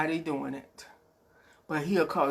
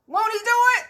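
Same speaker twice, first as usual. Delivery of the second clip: much higher, louder catchphrase; imitating Paul Martin; 6 dB louder, faster than usual. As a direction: belt it out like the radio host. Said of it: "I can't say it like Paul Martin say".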